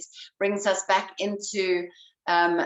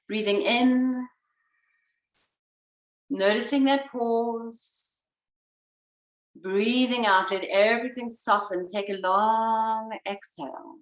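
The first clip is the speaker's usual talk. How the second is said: calm instructor voice, unhurried; guided breathing. Acted.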